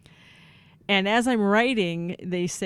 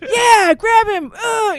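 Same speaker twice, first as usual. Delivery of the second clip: high, loud slow bellow, cheering for a tackle; hammed-up sideline parent roaring, much higher and louder; yelling from the stands; 13 semitones higher, 11 dB louder, slower than usual. Parents cheering kids at football, loud high yelling. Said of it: "we're yelling things like".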